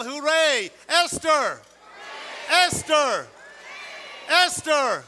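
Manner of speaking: loud cheering shout for the name; yelling cheer. Shown as a demonstration. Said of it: "I want everyone to yell".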